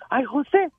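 high joke voices. Retold or imitated. María and José dialogue.

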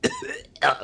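high, loud retching sound; demonstrated retch. Shown as a demonstration. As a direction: show how you dry-heaved. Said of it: "like that".

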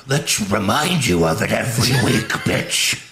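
lightly voiced sneering boss; mock villain voice, laughing through it; Vince impression. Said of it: "Vince is like".

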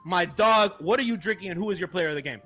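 ordinary delivery

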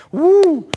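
loud high laughing woo; preacher whooping with joy over the verse; joyful whoop. Real, not acted. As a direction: let out a delighted whoop. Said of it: "I'm making myself happy preaching it".

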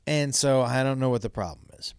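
ordinary delivery

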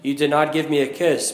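usual speaking voice

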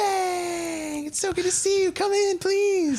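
put-on very high, excited little girl. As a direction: squeal it like a thrilled small child. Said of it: "a little girl answers the door, and she's like".

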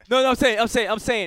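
high, loud, insistent cut-in; loud protest. Real, not acted. To friends accusing him, loud protest. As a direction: shout over them to get the floor. Cutting in during heated debate.

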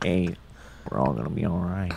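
low, soft soothing imitation; Owen Wilson. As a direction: settle a kid down quietly in an actor's voice. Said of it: "like Owen Wilson calming kids down on planes".

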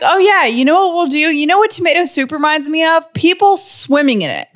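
imitating a marketing exec; mock marketer pitch. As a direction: pitch the silly idea like an eager ad man. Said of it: "who was like".

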